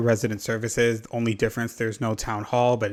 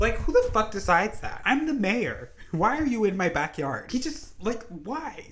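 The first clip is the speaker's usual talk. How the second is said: high-pitched exasperated rant; furious disbelief, pitch climbing; outraged rant; 10 semitones higher than usual. Real, not acted. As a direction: shriek your disbelief at the neighbour. Outraged rant about villager.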